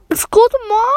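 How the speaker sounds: high, loud put-on "excuse me"; mock affront. To people who stare at the speaker, mock affront. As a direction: throw it out high and loud, hammed-up offence. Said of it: "whenever people stare at me, I'm like".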